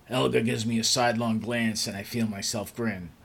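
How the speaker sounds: plain reading voice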